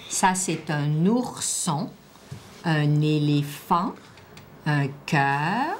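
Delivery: slow, drawn-out single words; careful word dictation. Shown as a demonstration. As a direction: give each word room, stretching it out. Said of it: "écoute bien le nom des dessins".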